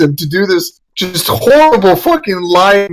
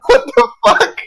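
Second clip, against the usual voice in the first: incredulous squeak. Real, not acted. Baffled at Bob's argument.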